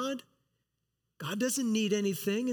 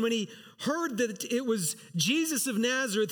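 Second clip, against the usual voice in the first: ordinary delivery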